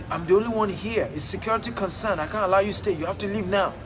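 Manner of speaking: usual speaking voice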